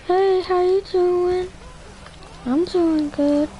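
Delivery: very high, slow put-on girly voice; trying to fool someone with a girl voice. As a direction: stretch it out high and sweet like a girl. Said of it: "that voice that I just did".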